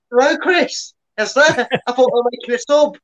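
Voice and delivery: high excited pundit voice; Chris Kamara. Retold or imitated. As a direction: pitch it up, baffled pundit. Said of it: "I can do my best Chris Kamara".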